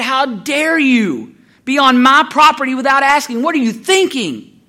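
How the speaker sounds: loud, high, drawn-out outrage; slow, scolding mock yell; screaming old lady. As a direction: imitate an indignant old woman telling off a trespasser. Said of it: "screaming at you".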